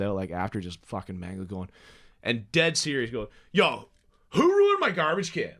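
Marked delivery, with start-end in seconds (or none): 3.5-5.5 high, loud mock outrage; furious. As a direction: demand an answer like a tripping buddy. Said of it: "Like, just furious".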